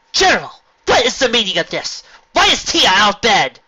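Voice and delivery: loud, high angry demand; angry mother. A narrator's reading. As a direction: demand an answer. Mother confronts the general.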